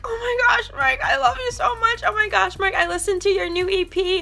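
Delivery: imitating her own wrecked voice, squeaky high and strained; sounding voice-shot. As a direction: squeeze the words out high through a blown-out voice. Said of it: "So I sound like".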